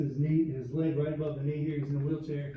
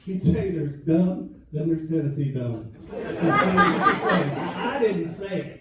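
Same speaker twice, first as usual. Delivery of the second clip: louder, pitched up, laughing; loud teasing; 3 semitones higher, 7 dB louder than usual. Real, not acted. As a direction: rib the Tennessee guy. Loud teasing of Tennessee fan.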